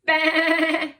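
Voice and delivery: loud, high, wobbling baa; sheep bleat. Shown as a demonstration. Teacher showing children how to baa, big sheep bleat. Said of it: "we won't just go baa, we'll go".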